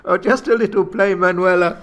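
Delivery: put-on flirty Spanish accent. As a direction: tease her playfully. Antonio coaxes, loud.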